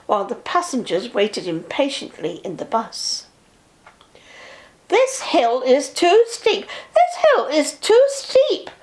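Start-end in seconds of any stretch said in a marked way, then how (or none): 4.9-8.7 slow, rising grumble, high on the second; grumbling coaches. A narrator's reading. Coaches grumbling behind Edward up the steep hill, slow then high. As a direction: chug out the complaint like tired coaches. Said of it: "grumbled the coaches".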